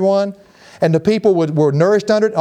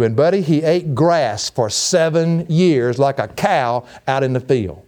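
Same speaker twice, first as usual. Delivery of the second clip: ordinary delivery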